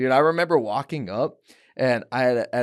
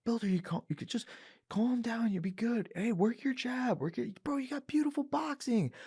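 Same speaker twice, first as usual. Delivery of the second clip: high, softer coaching; soft coach advice; 11 semitones higher, 8 dB quieter than usual. Retold or imitated. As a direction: coax him gently between rounds.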